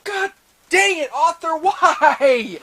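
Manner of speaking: high pleading why, laughing; exasperated. Real, not acted. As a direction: groan at the author. Exasperated plea to author.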